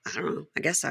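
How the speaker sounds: ordinary talk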